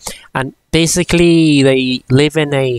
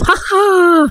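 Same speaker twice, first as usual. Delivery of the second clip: mock giggle; 4 dB louder than usual. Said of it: "sort of mood".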